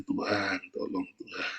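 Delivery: breathy whispered plea; whimpered prayer. Pretend. Preacher voicing a believer's moaning prayer, whispered. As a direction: beg God under your breath. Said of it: "kamu merintih".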